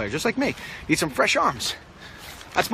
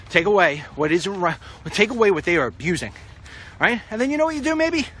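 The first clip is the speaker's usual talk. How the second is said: ordinary talk, nothing marked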